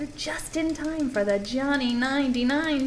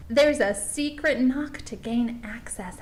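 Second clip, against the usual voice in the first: usual speaking voice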